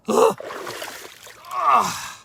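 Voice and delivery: loud, high, drawn-out sigh; big sighing exhale. Real, not acted. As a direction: let out a big huffing breath. Catching breath on the walk.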